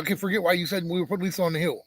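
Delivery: ordinary talk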